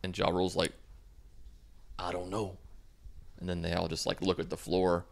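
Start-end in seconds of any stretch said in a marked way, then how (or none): 2.0-2.6 imitated awkward reply. Put on the spot by her mom, soft awkward reply. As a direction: answer much quieter, as if on the spot.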